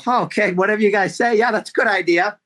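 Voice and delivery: high, eager mock yes-man; mock people-pleaser. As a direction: play the meek yes-man. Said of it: "I used to go in and go".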